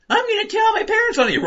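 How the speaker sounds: put-on very high squeaky child voice; mock little kid; whiny kid. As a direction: tattletale kid threatening. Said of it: "drowning a kid".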